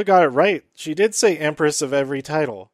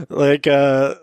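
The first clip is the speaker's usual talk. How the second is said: ordinary delivery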